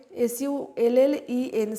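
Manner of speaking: slow letter-by-letter spelling; teacher spelling sullen for students. Shown as a demonstration. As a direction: dictate each letter clearly, one at a time. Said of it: "সালেন".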